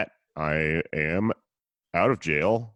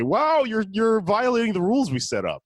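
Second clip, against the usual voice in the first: high, mocking fake-polite cop; 12 semitones higher than usual. Host mocks police at protest, higher mocking cop voice. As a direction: sneer it in a higher prissy voice. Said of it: "They say".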